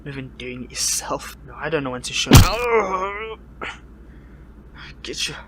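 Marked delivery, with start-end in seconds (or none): 1.5-3.4 loud, rising into a yell; defiant outburst. Acted. Captive hero defying the villain, loud outburst. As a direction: defiance that breaks into a long, high cry.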